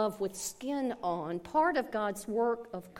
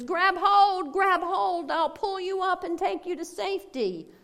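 much higher, louder call over the rotor noise; shouting pilot; 10 semitones higher, 9 dB louder than usual. Acted. Helicopter pilot shouting to the man to grab the rope ladder, loud and high. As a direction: yell down the ladder to him.

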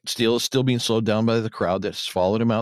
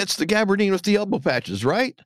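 higher-pitched acted query; feigned checking question; 10 semitones higher than usual. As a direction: ask it as if innocently double-checking. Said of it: "deliberately gives a false description of the jacket, asking".

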